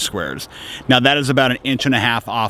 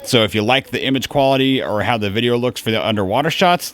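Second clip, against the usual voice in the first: normal talking voice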